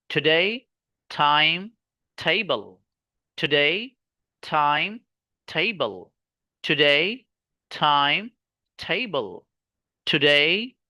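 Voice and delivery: today and time rise, table falls; rising, rising, falling chant; list rhythm. Shown as a demonstration. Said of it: "जो अंदाज मैं आपको बता रहा हूं".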